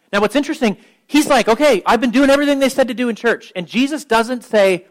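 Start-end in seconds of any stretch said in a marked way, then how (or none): 1.5-3.5 high, loud, quick mock reply; imitating eager self-assurance. Rich young man assuring Jesus he kept the rules, eagerly. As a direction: rattle it off eagerly, pitched up.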